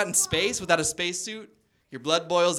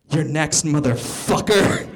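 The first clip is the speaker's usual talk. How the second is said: loud, slow mock-sinister taunt; hammed-up whispered threat played loud; menacing threat; 11 dB louder, slower than usual. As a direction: lean in and gloat at him.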